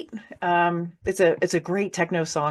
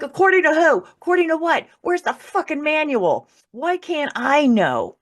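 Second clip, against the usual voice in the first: much higher and louder, demanding answers; angry outburst; 9 semitones higher, 3 dB louder than usual. Retold or imitated. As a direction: rage at the unseen voice. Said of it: "And then I got mad".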